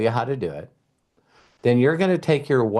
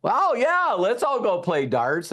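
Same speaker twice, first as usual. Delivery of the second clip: eager family; 9 semitones higher than usual. Retold or imitated. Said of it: "my family is like".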